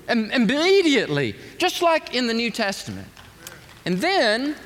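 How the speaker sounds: high, loud preacher's build; fervent pulpit delivery; impassioned preaching. Acted. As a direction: preach it out to the crowd at full force. Preaching the healing story.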